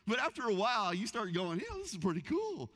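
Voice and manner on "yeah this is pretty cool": delighted. Retold or imitated. Enjoying VIP treatment.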